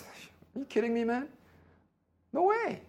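high-pitched mock incredulity; acted disbelief. Pastor retells the talk with the seminarian, acted disbelief. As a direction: voice leaps up on a retold protest.

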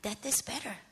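very soft, low, as a dark thought; quiet inner thought. Retold or imitated. Daughter at her mother's bedside thinking to herself, hushed. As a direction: let the thought slip out softly. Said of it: "this thought comes to my mind".

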